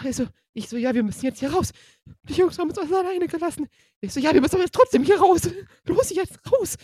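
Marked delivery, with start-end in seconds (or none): 0.8-1.7 mildly urgent, higher and louder; re-enacted pleading with rising urgency; urgent retelling. Retold panicked argument, urging to leave. 2.2-3.7 high, hurried mock-panicked protest; imitated panicky outburst, louder; panicked complaint. Retold panicked complaint of abandoned girl. 4.2-6.8 high, loud, re-enacted urging; urgent insistence. Retold argument, urgently pushing to flee.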